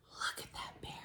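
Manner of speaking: hushed whisper at the picture; soft whispered aside. Real, not acted. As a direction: whisper it like sharing a secret.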